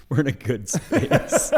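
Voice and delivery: high, slow words breaking into laughter; laughing. Real, not acted. Co-host amused that society's like that, laughing. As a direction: crack up while saying it. Cracks up at the joke.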